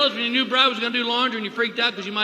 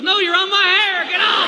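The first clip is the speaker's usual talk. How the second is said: high, loud yelp of protest; pained, exasperated mock shout; irritated wife; 8 semitones higher, 3 dB louder than usual. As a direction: shout at him to get off you. Wife snaps at husband in bed.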